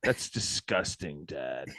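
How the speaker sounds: disgusted. Retold or imitated. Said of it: "they'd be like".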